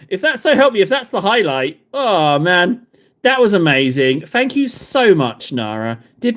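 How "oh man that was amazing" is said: ordinary talk, nothing marked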